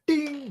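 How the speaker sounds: high loud ding; ding sound. Pretend. Friend mimicking a bell as the recipe clicks, high ding. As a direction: chime like a bell going off.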